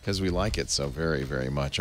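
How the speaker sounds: usual speaking voice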